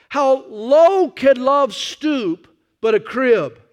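slow, high, loud preacher's cry; preaching. Acted. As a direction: stretch each word like a sermon climax. Preaching at full force.

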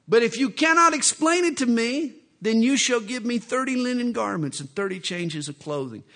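a lightly voiced cocky Samson, proud of his riddle; Samson's wager.

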